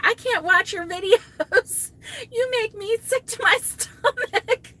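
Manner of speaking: high, loud laughing complaint; laughing tease. Real, not acted. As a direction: tease a friend while laughing. Teasing Lori about shaky videos.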